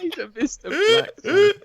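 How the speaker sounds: put-on very high, slow Aussie accent with laughter; mock Australian accent. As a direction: stretch it out in silly Australian, laughing. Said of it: "we are known for our mocking Australia".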